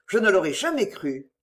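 ordinary delivery

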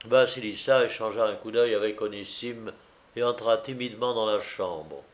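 usual speaking voice

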